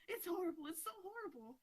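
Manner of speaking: high-pitched, panicky "horrible"; mock freaking out. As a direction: act out Tintin's horror at the spider, pitched well up. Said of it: "freaking out".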